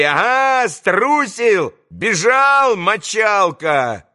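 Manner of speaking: high, drawn-out taunting shout; jeering clamour. A narrator's reading. Group mocks the fleeing boy, jeering clamour. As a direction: jeer at the coward, pitched well up. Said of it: "В группе загалдели".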